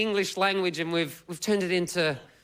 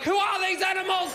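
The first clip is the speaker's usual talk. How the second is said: high shouted appalled horse voice; horrified horse; 12 semitones higher than usual. Retold or imitated. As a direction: play a shocked horse yelling at the crowd. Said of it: "the horses are looking around like".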